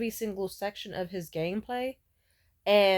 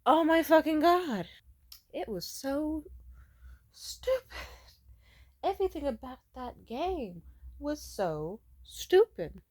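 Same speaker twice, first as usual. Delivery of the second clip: exasperated disbelief; 6 semitones higher, slower than usual. Real, not acted. Ranting about the stupid game.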